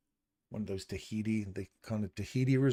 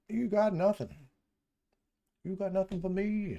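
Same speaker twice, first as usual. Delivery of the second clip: much higher mock-taunting voice; high teasing singsong; 10 semitones higher than usual. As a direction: tease the chart up high. Teasing the flat charts.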